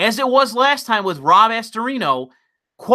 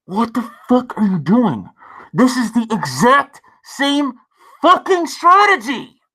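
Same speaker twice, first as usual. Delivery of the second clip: exasperated inner voice. Retold or imitated. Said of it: "you say to yourself, like".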